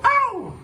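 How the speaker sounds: high, squeaky pretend ouch; mock yelp of pain. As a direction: yelp like a kid who got hit. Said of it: "she'll go".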